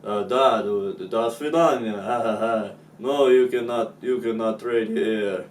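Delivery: loud mock Russian with a fake laugh; mock Russian accent. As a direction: ham up a Russian refusal. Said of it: "the Russians were like".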